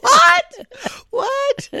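high-pitched mock-alarmed yelp; shocked reaction. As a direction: play startled awake, voice shooting way up. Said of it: "you respond like this".

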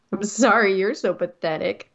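mock sorry with a drop into the put-down; backhanded apology. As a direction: act out an insincere, scornful apology. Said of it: "apologize in a very backhanded way".

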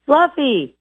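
high called-out name; calling the dog. Retold or imitated. Owner calling the dog by name, high and called out. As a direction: call the dog's name across the yard. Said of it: "They say it again".

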